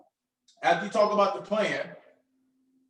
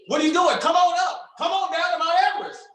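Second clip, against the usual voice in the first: higher, louder hard-sell ad voice; pushy commercial pitchman; 7 semitones higher, 4 dB louder, faster than usual. Retold or imitated. Coach imitating the Everest commercial guy to viewers, loud hard sell. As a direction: bellow it like a TV pitchman calling you in. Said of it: "the dude on the Everest commercial".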